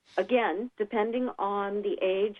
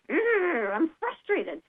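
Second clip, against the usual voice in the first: frustrated groan; 6 semitones higher, much slower than usual. Shown as a demonstration. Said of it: "makes you wanna clench your fingers".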